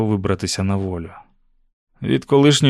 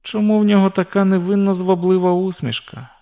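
put-on higher-pitched girl's inner voice; young heroine warning herself; 7 semitones higher than usual. A narrator's reading. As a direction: caution yourself as the girl, high-pitched. Narrator voices Susan warning herself. (дівчина високим голосом застерігає себе)

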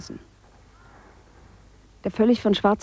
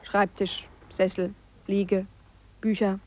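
normal talking voice

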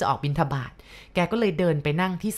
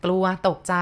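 ordinary delivery, nothing marked

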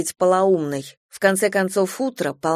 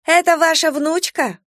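excited exclaim; 10 semitones higher, 4 dB louder than usual. Acted. Passerby exclaims to grandmother.

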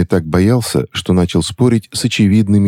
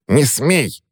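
sharp high hiss; hissed through teeth; 9 semitones higher than usual. Acted. Witch forbids the boy's name, hissed high. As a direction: spit it through clenched teeth. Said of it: "процедила она".

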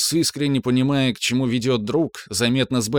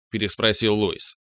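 plain reading voice

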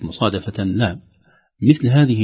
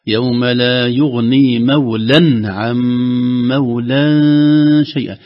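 slow chant with long nasal holds; tajweed recitation; 2 semitones higher, 5 dB louder, much slower than usual. Shown as a demonstration. Teacher modelling the verse for the caller, slow tajweed recitation. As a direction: recite slowly, drawing out each long sound.

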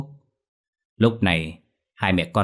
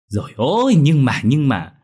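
flustered; 6 semitones higher, 6 dB louder than usual. Acted. Mother stammers objections.

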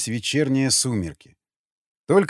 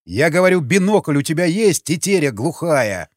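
shouting at a deaf man; 3 semitones higher, 4 dB louder than usual. A narrator's reading. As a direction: yell so the deaf guard hears. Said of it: "закричал Васнецов".

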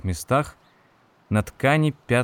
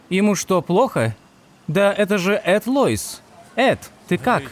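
higher, louder worried crowd voice; concerned bystander; 9 semitones higher, 4 dB louder than usual. A narrator's reading. Bystanders call out to Ed in worried, raised voices.

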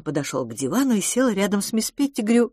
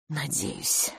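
much softer, weak reply; faint; 2 semitones lower, 8 dB quieter than usual. A narrator's reading. The governess answers the suitor faintly and softly. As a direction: barely voice the hope. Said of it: "слабо сказала".

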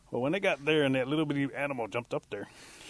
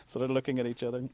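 ordinary delivery

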